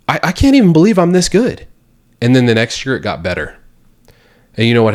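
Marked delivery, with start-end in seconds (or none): 0.1-1.7 higher, excited thought said aloud; amazed disbelief. Retold or imitated. Recalling his own progress, excited higher disbelief. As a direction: marvel at how good you've become. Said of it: "I thought I was like".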